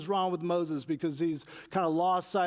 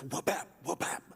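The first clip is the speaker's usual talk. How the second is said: striking sound effect; 5 dB quieter than usual. Retold or imitated. Imitating Moses striking the rock.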